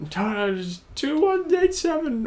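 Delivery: high, drawn-out mock scream. She screams at the droid from the compactor, hammed-up high scream. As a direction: wail it slowly in a very high pitch. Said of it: "she'll be screaming at C three P O".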